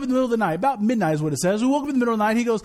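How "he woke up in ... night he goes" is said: ordinary talk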